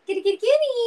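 high, loud call to a cat; sing-song high kitty call. Real, not acted. Calling out to the cats.

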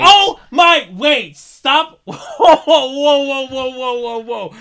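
high, loud, laughing disbelief, fading; shocked shout. Real, not acted. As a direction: cry out in stunned disbelief. Stunned at the same pick.

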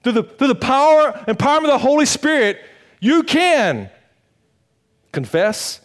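high, loud preaching shout; fiery shout. Acted. Pastor calling out his listeners' excuse, shouting. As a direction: blast the lie away.

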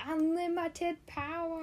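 high, slow villain proclamation; evil emperor voice. Retold or imitated. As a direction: draw it out like a gloating sith lord. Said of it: "when he goes".

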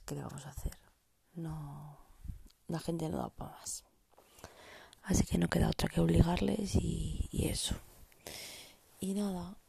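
hushed late-night whisper. Real, not acted.

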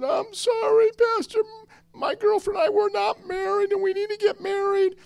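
very high, tearful wail; exaggerated high-pitched sobbing confession; mock crying. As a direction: imitate a big man blubbering an apology. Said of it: "He's crying".

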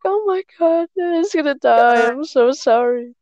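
high, slow imitated kid's wail; crying kid. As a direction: replay a little kid sobbing sorry. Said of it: "I was like".

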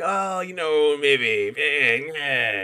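mock-coy talking cat; evasive cat. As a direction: dodge the question playfully. Voicing the philosophical cat.

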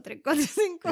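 tres cuatro cinco said loud and high; loud emphatic. Shown as a demonstration. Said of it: "Isn't it more easier?".

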